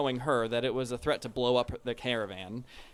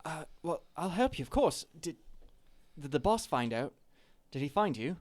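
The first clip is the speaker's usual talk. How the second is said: put-on Cockney accent; 5 semitones higher than usual. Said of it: "I keep switching accents".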